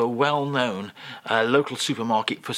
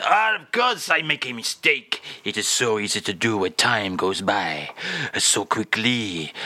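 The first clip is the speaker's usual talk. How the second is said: [name]; 4 semitones lower than usual. Acted. French guest excuses his mistake to the host, mock [name]. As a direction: start with an exclamation, then turn wistfully French. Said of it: "[name] is here".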